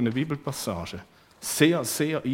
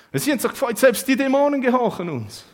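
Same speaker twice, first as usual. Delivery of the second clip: much higher, louder glee; disciples rejoicing; 11 semitones higher, 7 dB louder than usual. Acted. Disciples rejoicing over demons obeying them, high glee. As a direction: burst out with delighted triumph.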